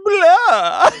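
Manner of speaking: high, loud posh vampire "Blah"; British Dracula accent. Pretend. Cohost asks for "blah" in the British accent, loud and high. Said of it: "Can you say blah in that accent?".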